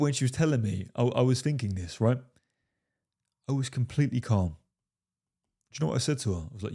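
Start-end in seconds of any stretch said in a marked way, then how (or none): none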